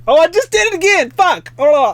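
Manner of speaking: high, loud cry of dismay; exasperated yelp. Real, not acted. Streamer blinded by her flashlight again, high loud yelp. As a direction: yelp it out, caught off guard.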